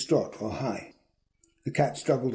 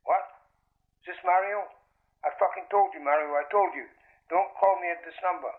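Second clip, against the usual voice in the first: higher voice through a telephone line; thin phone voice, worked up and pitched up; irritated phone caller; 7 semitones higher than usual. A narrator's reading. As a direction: tell someone off down the line, voice raised in pitch.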